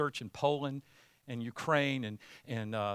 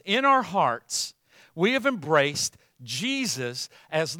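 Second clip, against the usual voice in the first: preaching; 3 semitones higher, 5 dB louder than usual. Acted. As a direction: preach it with rising conviction. Preacher builds toward confession.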